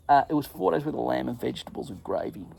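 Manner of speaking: normal talking voice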